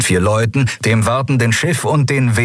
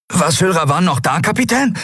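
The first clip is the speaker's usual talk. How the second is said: excited question; 11 semitones higher than usual. Acted. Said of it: "fragte Miko aufgeregt".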